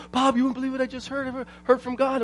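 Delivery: high, soft imitation of an eager caller; Todd, excited. Excited friend calling on the cell phone, imitated. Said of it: "he was so excited".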